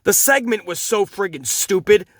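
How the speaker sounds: ordinary talk, nothing marked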